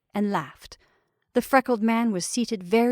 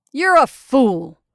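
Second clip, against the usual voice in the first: loud, slow, higher-pitched rebuke; angry insult; 4 semitones higher, 9 dB louder, slower than usual. A narrator's reading. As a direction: hurl it slowly and loudly. Insult ends a furious debate.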